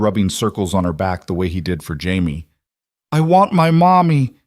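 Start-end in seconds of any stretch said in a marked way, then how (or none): 3.1-4.3 high, loud crying plea; sobbing child. A narrator's reading. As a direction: wail for mommy like a child. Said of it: "Emma sobbed into his shoulder".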